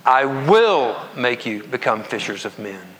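"will" drawn out and punched, slow reading; stress on will. Shown as a demonstration. Preacher rereading the verse aloud with congregation, stress on will. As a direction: lean on the word "will" and pause after it. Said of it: "reread it and emphasize the different".

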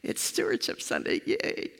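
soft, breathy, unimpressed yay; flatly mock the cheer from the beach; deadpan mock cheer. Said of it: "worshiping from a beach are going".

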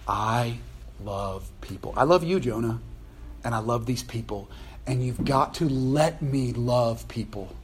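low, soft, word by word; tender declaration. Acted. God to Jonah about loving people, tenderly. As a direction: let each phrase land quietly and earnestly.

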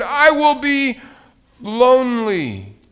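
high, loud cry, slowing at the end; desperate shout. A narrator's reading. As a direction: wail it, stretching the last word. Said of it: "he shouted".

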